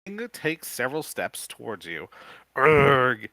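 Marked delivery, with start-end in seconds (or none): 2.5-3.3 loud groaning zombie moan. Acted. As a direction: let out a loud undead groan. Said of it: "it moans".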